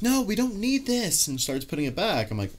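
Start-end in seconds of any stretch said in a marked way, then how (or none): 0.0-1.3 high-pitched, drawn-out wifely protest; slow, high mock scolding; imitating wife's objection. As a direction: mimic a wife putting her foot down, pitched up. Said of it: "then my wife's like".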